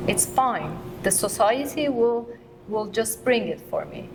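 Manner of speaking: normal talking voice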